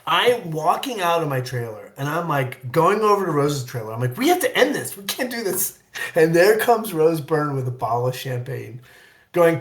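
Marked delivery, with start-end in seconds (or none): 4.2-5.8 high-pitched mock-panicked appeal; retold plea to call it off; urgent plea. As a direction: beg in a high voice. Retelling urgent plea to co-star.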